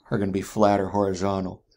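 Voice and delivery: ordinary talk, nothing marked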